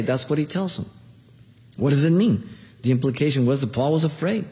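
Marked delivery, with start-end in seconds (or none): none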